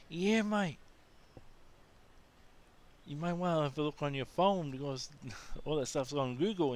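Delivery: an easygoing Australian local brushing it off; Aussie local. Retold or imitated.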